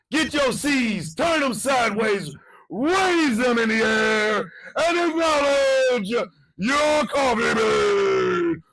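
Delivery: slow, loud, drawn-out call to the crowd; rallying shout. Real, not acted. Host leading the fans' ritual, slow rallying shout. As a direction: lead the ritual like a hype man.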